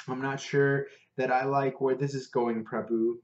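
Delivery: ordinary delivery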